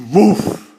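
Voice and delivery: big high booming woof, after a deep breath; loud dog woof. Shown as a demonstration. Narrator to children, imitating a booming dog bark. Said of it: "take a deep breath".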